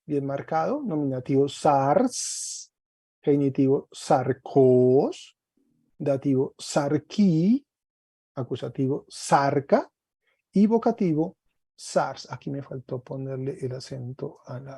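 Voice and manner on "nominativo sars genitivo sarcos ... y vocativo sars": slow, drawn-out declension recital; deliberate teacher's dictation, endings stretched; clearly marked. Shown as a demonstration. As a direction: spell out each case form slowly, with a gap between. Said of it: "yo se lo repito para que lo vean bien marcado".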